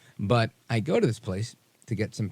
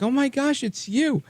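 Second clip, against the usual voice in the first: mock excited fan. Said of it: "she was like".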